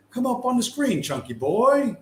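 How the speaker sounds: high, sing-song call to the screen; playful sing-song coaxing. Pretend. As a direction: coax it up like calling a pet. Coaxing the video to load.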